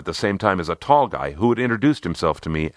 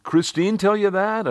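high cheerful call from the group; 13 semitones higher than usual. A narrator's reading. Team members answering newcomer in chorus, calling out high. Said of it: "they called out".

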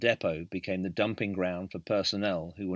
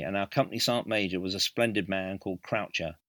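usual speaking voice

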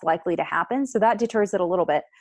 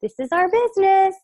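sweetly firm wife; 10 semitones higher, 4 dB louder than usual. Acted. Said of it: "her continuing to say".

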